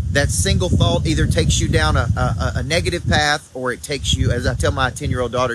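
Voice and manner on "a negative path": normal talking voice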